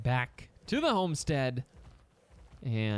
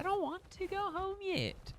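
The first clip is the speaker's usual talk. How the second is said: whiny high voice; 6 dB quieter than usual. Acted.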